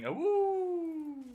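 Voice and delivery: high playful wolf howl; little wolf howl; howling like a wolf pup. Pretend. Warwick is coming, wolf howl.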